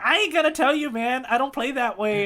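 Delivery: high, mock-taunting clown pirate; Buggy voice. Retelling the episode, voicing Buggy's high taunt. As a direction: sneer it out like a cocky clown. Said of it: "Buggy's like".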